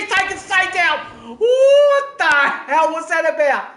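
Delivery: loud, high, anguished disbelief; real shocked outrage at the contact; dismayed outcry. Real, not acted. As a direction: cry out at the crash. Commentator sees driver taken out.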